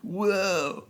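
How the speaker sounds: laid-back, high surfer drawl; surfer dude voice. Pretend. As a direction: play a chill beach surfer. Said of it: "I don't like it".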